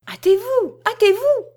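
high, loud cry to hurry; urgent shouted order. A narrator's reading. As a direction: shout to a valet in acted panic. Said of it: "ajouta Dubois en s'adressant au valet".

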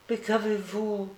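put-on high, soft little-boy question; anxious child's question. A narrator's reading. Sick boy asks why his friend cries, softly anxious. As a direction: ask gently in a high child's voice.

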